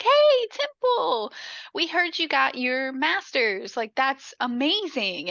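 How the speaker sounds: high, drawn-out mock-gushing hello; gushing greeting. Party couple cornering her with congratulations, mock gushing. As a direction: imitate an overfriendly party guest fawning.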